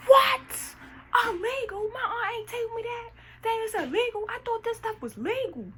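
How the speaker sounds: high, loud squealing mock panic; hammed-up frightened yelp; panicked disbelief. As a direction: squeak it out in horror. Panicked self quoting shock.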